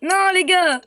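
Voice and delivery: loud, high protesting cry; frustrated shout. Real, not acted. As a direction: yell it out in dismay. Goal conceded, reacting.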